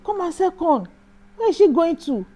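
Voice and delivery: still shouting. Retold or imitated. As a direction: holler after her as she walks off. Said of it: "And he still shouted".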